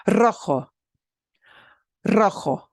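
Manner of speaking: rojo with a trilled initial r; strong trill. Shown as a demonstration. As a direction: open rojo with a strong rolled r. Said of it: "That one gets a strong trill".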